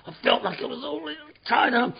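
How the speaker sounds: usual speaking voice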